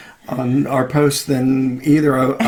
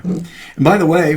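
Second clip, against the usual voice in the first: ordinary delivery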